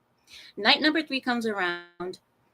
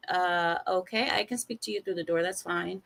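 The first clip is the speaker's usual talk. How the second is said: quiet, calming; 3 semitones lower, 6 dB quieter than usual. Retold or imitated. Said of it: "I said".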